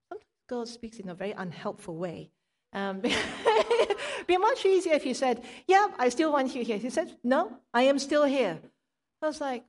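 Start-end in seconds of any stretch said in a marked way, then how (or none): 3.1-4.2 sudden high laugh, real amusement. Real, not acted. Laughs at God's unhelpful answer. 4.3-6.8 laughing complaint. Real, not acted. 7.2-8.6 "No, I am STILL here" said loud with STILL stressed and falling; firm loud reply. Retold or imitated.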